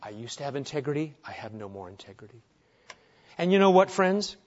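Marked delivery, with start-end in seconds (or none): 0.0-2.4 fast, soft confession; a broken man admitting he is ruined; hushed quick lament; undone Isaiah. Retold or imitated. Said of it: "he was undone".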